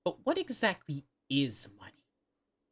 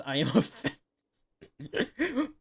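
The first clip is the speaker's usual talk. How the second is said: very slow, higher, laughing through it; laughing put-on voice; 7 semitones higher, much slower than usual. Shown as a demonstration. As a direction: stretch it out while cracking up.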